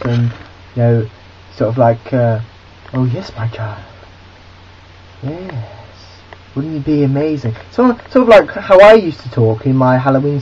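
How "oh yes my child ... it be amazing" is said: a hint of a creepy, crooning Hyde; eerie Mister Hyde voice. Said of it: "in a very eerie way".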